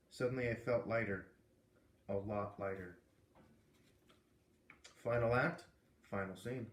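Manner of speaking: ordinary delivery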